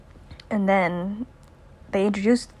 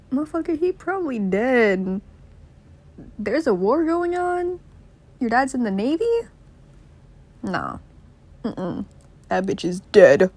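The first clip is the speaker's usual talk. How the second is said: high-pitched incredulous mock voice; Piggy scoffing; 4 semitones higher than usual. Boy doubts friend's dad will come, incredulous. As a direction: act out disbelief, pitched way up. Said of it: "And Piggy's like".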